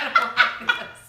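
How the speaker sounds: squeaky high laugh through the words; giggling while quoting herself. Retold or imitated. Telling friends, laughing at her own fear.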